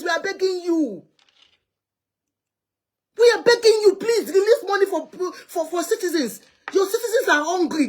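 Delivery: high-pitched, urgent appeal to the nation; impassioned plea. Real, not acted. Pleading appeal to Buhari on air.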